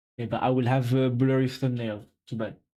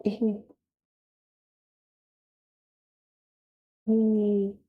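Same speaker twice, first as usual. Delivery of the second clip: high, drawn-out "oh"; posed astonished "ooh"; play-acted shock; 6 semitones higher, much slower than usual. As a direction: pretend to be shocked by dramatic news. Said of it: "Shocked gasp".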